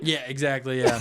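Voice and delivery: ordinary talk, nothing marked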